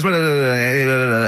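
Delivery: lightly mimicked fast talker babbling; mock rapid chatter. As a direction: rattle off blah blah like a nonstop talker. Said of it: "Robin sort of goes".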